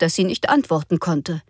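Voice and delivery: usual speaking voice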